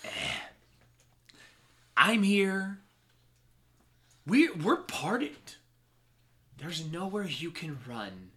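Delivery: lazy smug taunting; smug villain. Acted. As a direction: gloat over the captives. Villain gloats.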